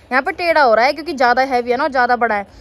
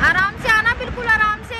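high calling voice across the crowd; 10 semitones higher than usual. Real, not acted. Mother calling to her running child, high and carrying.

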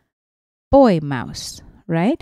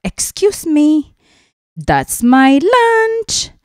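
high, loud squawking seagull demanding its lunch; a bossy gull shrieking for its food; greedy seagull voice; 10 semitones higher, 12 dB louder, slower than usual. Acted. Seagull voiced in read-aloud book.

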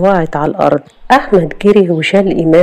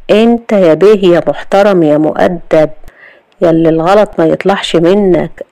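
usual speaking voice

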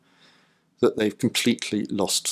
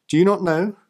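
higher, louder reproachful question; Paul's challenge echoed, higher; rhetorical rebuke; 8 semitones higher, 3 dB louder than usual. Acted. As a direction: press the point home.

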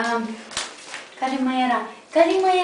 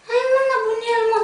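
playful puppy coo; 10 semitones higher than usual. Real, not acted. Teasing the wild puppy.

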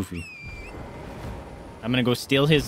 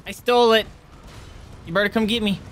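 taunting; 8 semitones higher than usual. Acted. Gamer taunting the boss after stealing his loot, mocking. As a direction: goad him into chasing you.

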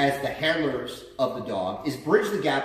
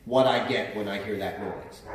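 usual speaking voice

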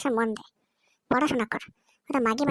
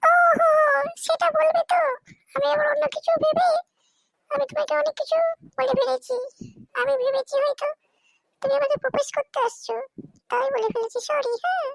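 put-on apologetic girl voice; 13 semitones higher than usual. New girl apologizing to the boy she snubbed, high sweet girl voice. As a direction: play the schoolgirl backing down with a sheepish apology.

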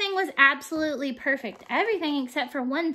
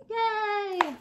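cheer; 6 semitones higher, 3 dB quieter than usual. Real, not acted. Cheering the story's end.

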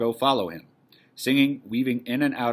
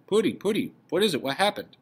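higher, worried questioning; alarmed concern; 8 semitones higher than usual. Acted. Wife to husband who suddenly sobs, alarmed. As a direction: fuss anxiously over him.

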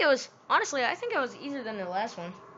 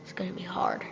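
whisper; 4 semitones lower, 8 dB quieter, faster than usual. Real, not acted. Quiet remark to himself.

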